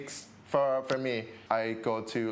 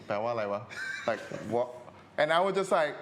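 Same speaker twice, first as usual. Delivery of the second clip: normal talking voice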